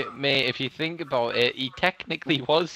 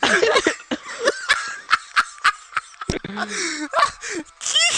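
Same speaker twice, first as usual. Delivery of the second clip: long, high shrieking laughter; loud, helpless high-pitched cackling; uncontrollable laughing fit; 5 dB louder than usual. Real, not acted. Friend told he sounds like a creepy clown, helpless laughing fit. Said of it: "[name], calm down".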